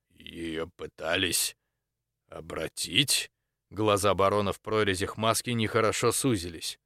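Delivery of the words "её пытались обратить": low, soft, very slow question; ominous disbelief. A narrator's reading. Baron learns what was tried on his wife, ominously. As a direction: draw it out low and hushed.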